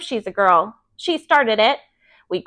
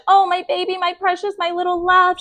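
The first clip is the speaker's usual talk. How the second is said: doting mother; 8 semitones higher than usual. A narrator's reading. Mother comforting hurt child.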